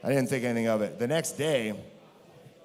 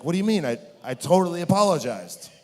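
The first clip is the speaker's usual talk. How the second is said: baffled protest; 5 semitones higher, 5 dB louder than usual. Retold or imitated. Confused protest to the boss.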